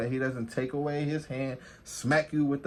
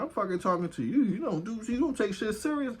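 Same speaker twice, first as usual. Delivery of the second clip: retold confrontation; 8 semitones higher than usual. Partner answering back in an argument, mock indignant. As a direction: imitate an indignant comeback, voice raised in pitch. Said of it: "they're about to start fighting".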